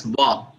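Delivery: "va" as a wa-like French sound; OI pronounced "va". Shown as a demonstration. Explaining French OI to a colleague, pronounced "va". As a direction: say "va" for the letters OI. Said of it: "always pronounced as va".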